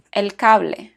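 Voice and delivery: "cable" said in Spanish as "CA-ble", stress on "ca", the e sounded; Spanish pronunciation. Shown as a demonstration. Teacher models a Spanish -ble word for learners, Spanish pronunciation. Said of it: "stressed on the next to the last syllable".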